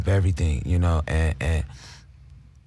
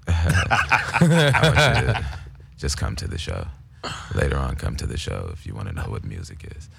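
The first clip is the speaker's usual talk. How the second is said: [name] impression; 11 semitones lower than usual. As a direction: draw it out low like [name] hyping his show. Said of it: "[name] was a little dude".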